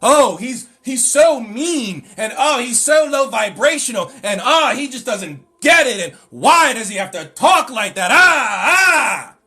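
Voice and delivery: mocking whine. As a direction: imitate the offended followers whining. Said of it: "I'm the bad guy".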